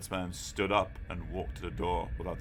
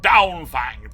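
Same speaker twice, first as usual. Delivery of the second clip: louder dog command "Down, Fang"; 8 semitones higher, 11 dB louder than usual. A narrator's reading. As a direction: order the dog off firmly. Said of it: "a loud command".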